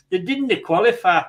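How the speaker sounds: badly faked Scottish accent; Andy Cameron. Host teasing Doug about Scotland not qualifying, bad Scottish accent. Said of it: "that was a terrible Scottish accent".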